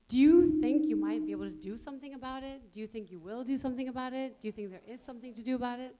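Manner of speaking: ordinary delivery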